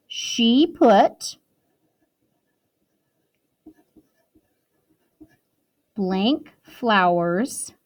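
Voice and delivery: slow, word-by-word dictation; dictating. Shown as a demonstration. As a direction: spell it out slowly for writing. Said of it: "period at the end".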